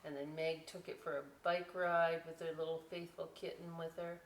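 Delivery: ordinary talk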